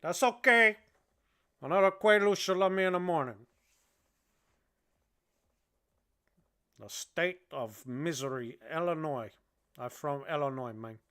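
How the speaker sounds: put-on lightly held Cuban gangster accent; a hint of the Cuban accent, half-crazed and joking. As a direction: keep the Cuban accent, loose and rambling. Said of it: "my accent".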